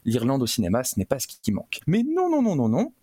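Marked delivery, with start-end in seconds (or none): 1.9-2.9 playful refusal. Acted. Host playfully rejects serious films.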